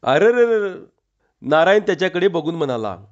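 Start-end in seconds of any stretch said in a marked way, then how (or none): 0.0-0.7 open-mouthed dismayed cry; dismayed exclamation. A narrator's reading. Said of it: "तोंड फाकून म्हणाला".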